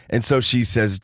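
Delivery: normal talking voice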